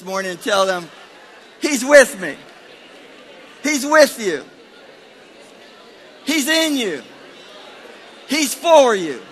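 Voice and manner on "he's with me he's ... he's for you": high, loud short declarations; rousing preaching. Real, not acted. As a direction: proclaim each phrase for the church to repeat. Gives congregation words to repeat.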